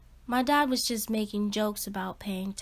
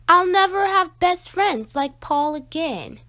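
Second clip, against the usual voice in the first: ordinary delivery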